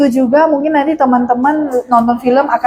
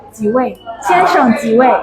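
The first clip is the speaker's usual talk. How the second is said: slow, courteous waiter asking how many; bow slightly in the voice and ask slowly; polite waiter; slower than usual. Retold or imitated. Waiter greeting a guest at a Chinese restaurant, polite and slow. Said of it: "dengan sangat sopan dia bilang".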